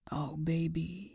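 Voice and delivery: soft, slow murmur; soothing a crying partner, slow and soft. Acted. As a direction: murmur it gently while holding her close. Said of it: "he murmured as he held her".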